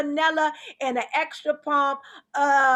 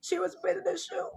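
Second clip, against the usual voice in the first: mock sobbing; 4 semitones higher, 7 dB quieter, faster than usual. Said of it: "Y'all see the tears?".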